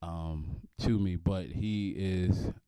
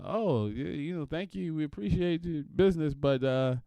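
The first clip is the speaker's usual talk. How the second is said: higher, sugary polite letter voice; polite bill collector; 6 semitones higher than usual. Retold or imitated. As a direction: sweetly break the bad news. Voicing the bank letter.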